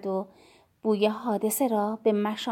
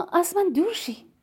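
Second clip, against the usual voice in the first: «از من دور شی» pitched higher, voiced, at normal level; higher insistent repeat; 6 semitones higher than usual. A narrator's reading. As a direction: repeat it up high with a push on «من». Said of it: "با صدای آرام گفتم". (تکرار با صدای بلندتر و زیرتر)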